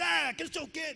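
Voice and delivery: high, loud burst of arguing; mock shouting. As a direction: imitate your old self yelling back in a row. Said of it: "Que antigamente eu falava".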